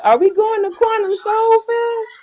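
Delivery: imitating nagging kid. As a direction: mimic a child who asks the same thing every day. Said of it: "every day I gotta hear".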